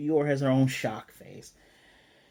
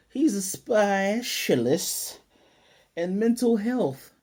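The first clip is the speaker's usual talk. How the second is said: high, loud, drawn-out words; little girl's careful save; 7 semitones higher, 6 dB louder, much slower than usual. Retold or imitated. Girl nearly blurts a secret about her dad, drawn-out high imitation. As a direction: stretch it out high and loud, mimicking a child. Child's near slip, drawn out.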